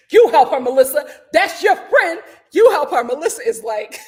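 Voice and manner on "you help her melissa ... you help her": loud, forceful mock order; acted stern refusal. Teresa refusing to help, passing it to Melissa, loud. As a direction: push the job away with loud insistence.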